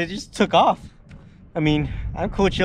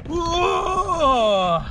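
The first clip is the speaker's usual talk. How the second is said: high startled shout; surprised whoa; 10 semitones higher than usual. Real, not acted. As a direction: gasp out in surprise. Boat suddenly moves.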